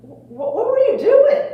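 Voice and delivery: high, loud stunned yell; disbelieving shout at the kid; incredulous coach. Retold or imitated. Coach stunned after the boy runs from the play, loud and high. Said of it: "the coach says to him".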